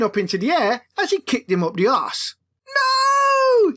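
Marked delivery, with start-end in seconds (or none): 2.7-3.7 very high, loud despairing cry; long drawn-out loud wail of no; anguished cry. Acted. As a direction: scream as the sign flies away. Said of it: "he cried as the storm finally tore".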